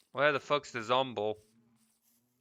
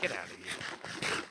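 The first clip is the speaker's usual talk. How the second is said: muttered under breath; 6 semitones lower, 9 dB quieter, slower than usual. Real, not acted. Shooing mob under breath.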